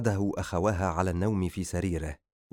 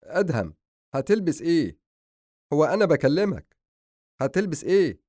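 raised, insistent questioning; exasperated, louder pestering; impatient; 8 semitones higher, 4 dB louder than usual. Acted. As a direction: demand an answer from someone ignoring you. Brother repeats unanswered question.

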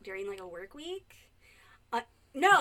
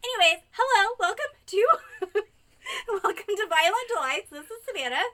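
higher, louder host welcome; bright show opener; podcast host intro; 9 semitones higher, 9 dB louder than usual. Acted.

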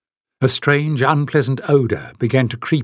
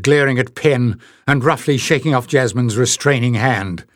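ordinary delivery

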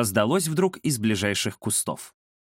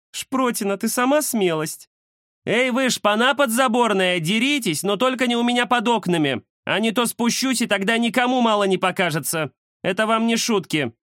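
bossy cat lady voice; 11 semitones higher than usual. Acted. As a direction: scold the alley cats from above. Indira scolds cats from window.

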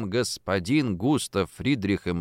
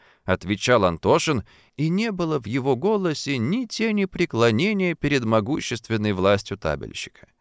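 ordinary delivery

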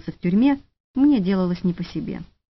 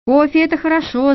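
higher-pitched, mildly angry pounce; 7 semitones higher, 4 dB louder than usual. A narrator's reading. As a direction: pick it up crossly, like an irate colonel. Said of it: "злым голосом подхватил полковник". (слегка злым голосом, повыше)

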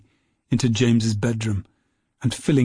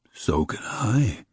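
slow, faraway snowman voice; chattering melting snowman voice; 3 dB quieter, much slower than usual. A narrator's reading. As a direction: speak slowly like a melting snowman. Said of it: "said the snowman".

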